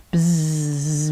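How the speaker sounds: low droning buzz like a bee; a kids' teacher doing a bee buzz. Pretend. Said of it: "you can buzz".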